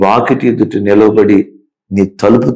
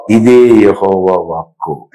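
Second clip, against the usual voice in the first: solemn declaration; 2 semitones lower, slower than usual. Acted.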